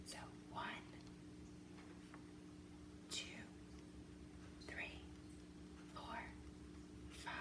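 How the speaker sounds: counting in a whisper; breathy whispered count. Shown as a demonstration. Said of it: "and whisper count as you work".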